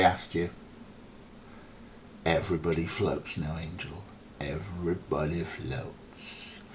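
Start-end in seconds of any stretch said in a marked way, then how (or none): 2.3-6.6 put-on sexy New Orleans accent. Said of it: "I'm doing my sexy New Orleans accent".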